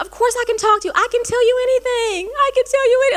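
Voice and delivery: mock wail, climbing high in pitch; crying. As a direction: imitate a tearful, high-pitched insistence. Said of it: "And I'm crying".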